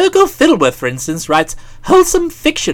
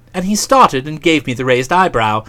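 ordinary delivery

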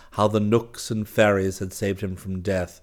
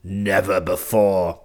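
slow, enraged opening words; restrained rage; 4 dB louder, slower than usual. A narrator's reading. King answers the woodsman's insult, slow understated rage. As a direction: draw the words out with contained anger. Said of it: "with his voice trembling with rage".

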